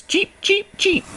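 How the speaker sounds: very high tiny chick cheeps; peeping chicks. A narrator's reading. Reading to children as little chicks, very high cheeps. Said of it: "scramble with the little chicks".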